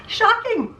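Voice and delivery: high, stunned exclamation; first cry of disbelief at the price; shocked. Real, not acted. Telling viewers the dealer's price, a high shocked cry.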